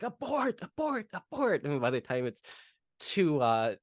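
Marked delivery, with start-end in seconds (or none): 0.0-1.6 much higher mock panic; hammed-up frantic order to abort; panicked abort. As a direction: squeal it like a villain losing his nerve. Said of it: "It's like".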